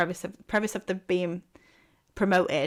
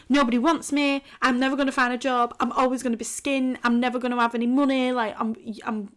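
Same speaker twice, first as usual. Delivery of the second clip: half-hearted panicky wail, higher; mock crying rant; 5 semitones higher, 3 dB louder than usual. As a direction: replay the meltdown lightly, pitched up. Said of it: "because I was just crying and being like".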